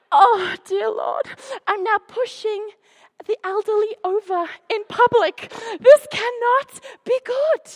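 half-laughing panic at her own plan; panicked prayer. Retold or imitated. Woman chasing a stranger, praying in comic panic. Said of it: "And I'm like".

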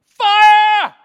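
desperate prophet bellowing fire; shouting fire. Acted. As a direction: yell it to the sky like Elijah. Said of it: "he starts shouting".

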